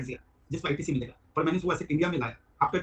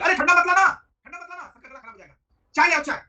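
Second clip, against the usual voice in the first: higher, louder shouted order; calling out orders; 10 semitones higher, 9 dB louder than usual. Retold or imitated. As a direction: bellow for tea across the shop. Shopkeeper yelling orders to staff.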